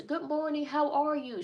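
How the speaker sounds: imitating a four-year-old's cheerful hey; little girl's hello. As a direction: call out like an excited preschooler.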